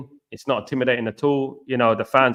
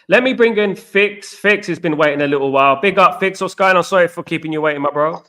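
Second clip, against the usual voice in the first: excited greeting; 5 semitones higher, 5 dB louder than usual. Real, not acted. Host welcomes caller onto stage.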